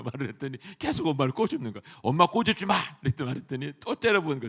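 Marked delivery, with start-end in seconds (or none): 2.0-2.8 higher, louder retold reprimand; mock-stern telling-off; firm scolding. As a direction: order a child to stop, sharply. Preacher retells scolding a small child.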